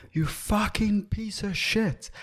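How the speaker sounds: slow, high, sneering mock abuse; imitated insult. Drivers in the car insulting a topless runner, slow mock sneer. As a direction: drag out the insult like a passing jerk. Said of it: "they're like".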